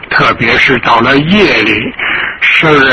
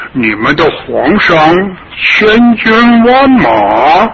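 arrogant foreign invader; 4 semitones higher than usual. Acted. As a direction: gloat and lord it over the old man. Foreign invader brags and threatens.

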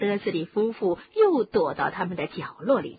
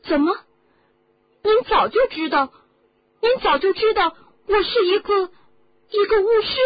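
high, loud shocked questions; astonished boy; 13 semitones higher, 11 dB louder than usual. Acted. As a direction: gasp it out in disbelief. Harry astonished at the news.

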